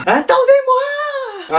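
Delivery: long high drawn-out cry; whiny plea to wait; wailing call. Acted. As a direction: call after them, dragging it out. Calling out, running late.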